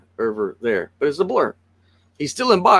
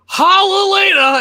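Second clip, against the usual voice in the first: much higher, louder, slow jubilant cry; retold shout; 8 dB louder, slower than usual. A friend at the movies shouting Hallelujah at the title screen. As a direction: belt it like a revival praise. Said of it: "And he goes".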